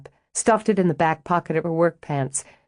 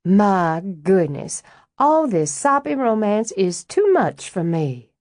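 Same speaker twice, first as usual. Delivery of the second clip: a light put-on Southern belle drawl, slowed right down; hammed-up Southern accent, teasing; mock Southern belle; 3 semitones higher, slower than usual. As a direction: flutter through it like an overdone Southern lady, rising high on the second line. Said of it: "did her best Woman of the South accent".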